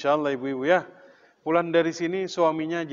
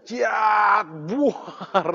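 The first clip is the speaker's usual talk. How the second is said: high, loud martial-arts style cry; imitated kick yell; 6 semitones higher, 4 dB louder than usual. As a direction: yell the kick, then the crash. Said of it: "dia teriak".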